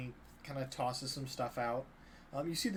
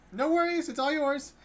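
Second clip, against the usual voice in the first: very high, loud, panicky squeak; acted scared surrender, high and loud; frightened halfling; 13 semitones higher, 7 dB louder than usual. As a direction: give in fast, pitch shooting up in fear. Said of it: "with fear in his eyes".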